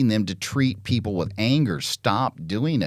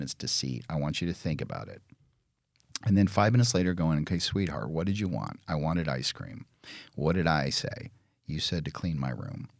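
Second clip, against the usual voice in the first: calm gentle parent; 5 semitones lower, 5 dB quieter than usual. Retold or imitated. Parent calmly guiding child.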